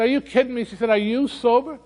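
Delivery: can't believe what she's hearing; high astonished "are you sober?"; surprised disbelief. Retold or imitated. Nurse amazed at sober doctor.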